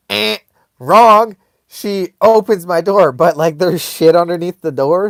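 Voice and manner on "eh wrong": loud, higher game-show wrong; big punchy correction; emphatic buzzer call. Real, not acted. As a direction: blurt it out loud and high. Buzzer joke, she comes back in.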